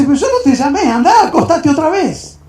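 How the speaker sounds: loud, high acted protest; exasperated. Woken old man sends the boy back to bed, exasperated. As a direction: send them off in exasperation.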